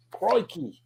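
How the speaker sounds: put-on Australian accent. Joking that everybody does it, mock Australian accent. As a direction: toss out a cliché Australian accent. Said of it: "everybody does the Australian accent".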